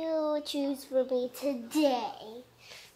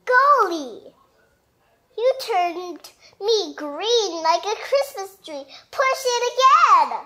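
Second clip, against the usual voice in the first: excited storybook voice; 4 semitones higher than usual. Acted. Parent reading to child, excited character turning green. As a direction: gush with glee.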